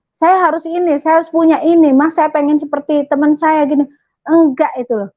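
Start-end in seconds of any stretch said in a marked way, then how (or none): 0.1-3.9 put-on higher, pleading child's wants; imitating a whiny child asking for things; mock demanding child. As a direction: play a child listing demands.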